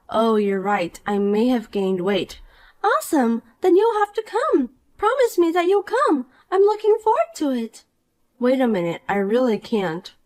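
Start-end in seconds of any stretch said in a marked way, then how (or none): 2.8-7.8 put-on bright, higher voice of a smug mean girl; snide rich girl. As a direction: talk down to her with sweet contempt. Poppy presses invitation.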